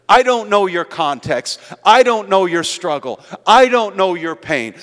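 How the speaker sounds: full-force preaching. Acted. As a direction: preach it hard to the whole church. Preaches to the congregation.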